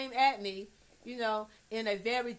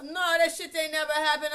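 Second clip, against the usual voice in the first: high, loud, quick denial; mock denial; 7 semitones higher, 8 dB louder, faster than usual. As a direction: act out disavowing it at the top of your pitch. Acted denial of a secret.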